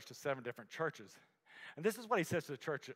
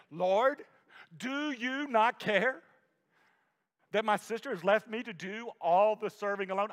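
raised, slow exasperated plea, higher and louder; Martha's complaint; 5 semitones higher, 6 dB louder than usual. Acted. Martha protests to Jesus about her sister, exasperated and loud. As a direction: drag out the grievance like a fed-up sister.

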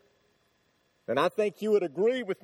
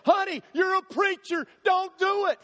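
pleading wife; 9 semitones higher, 3 dB louder than usual. Retold or imitated. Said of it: "my wife reaching for me".

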